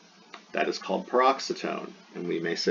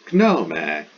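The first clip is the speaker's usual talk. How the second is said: "gnome" said in two syllables, pitch raised on the first; accent on the penult; 3 semitones lower, 6 dB louder than usual. Shown as a demonstration. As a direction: sound out "gnome" as Greek GNO-may, leaning on GNO. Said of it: "it has an accent on the penult".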